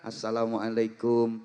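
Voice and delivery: low, soft bowing greeting; student bowing to teacher, quiet; humble student salam. Shown as a demonstration. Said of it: "sambil membungkukkan badan".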